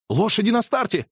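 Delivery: high, loud cry; shouted. Acted. Narrator shouts the horses are off, high. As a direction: call out the start. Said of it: "закричал я".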